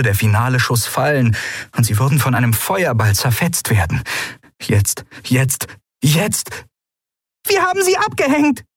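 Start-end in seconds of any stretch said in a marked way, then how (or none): 4.6-6.5 rising, tense countdown of dread; tense anticipation. A narrator's reading. Pilot waiting for the fatal shot, tense. 7.5-8.6 very high-pitched cheer; jubilant. A narrator's reading. Nelen celebrates losing the pursuers, jubilant. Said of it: "jubelte Nelen".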